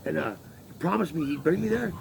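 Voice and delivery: ordinary delivery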